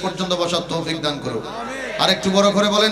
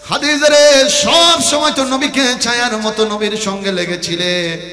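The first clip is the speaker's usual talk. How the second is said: high, loud chanted lament; ringing, intoned plea to a dying wife; impassioned preaching; 9 semitones higher, 6 dB louder than usual. Acted. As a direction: cry out the husband's grief in a preacher's chant. Preacher voices husband's lament.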